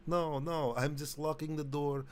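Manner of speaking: comically hospitable Russian; Russian accent. Acted. Russian host offering vodka to a frightened guest, Russian accent. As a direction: coax the guest in a heavy mock Russian accent. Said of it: "My, my Russian accent".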